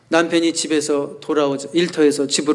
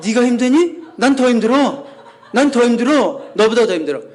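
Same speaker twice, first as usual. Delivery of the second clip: marital quarrel; 8 semitones higher, 3 dB louder than usual. Retold or imitated. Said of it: "막 이러면서 싸우고 있는 거야".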